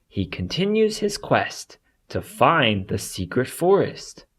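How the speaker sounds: ordinary delivery, nothing marked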